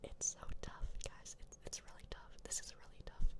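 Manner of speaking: hushed, voiceless whisper. Real, not acted. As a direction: whisper it under your breath. ASMR streamer whispering while thinking.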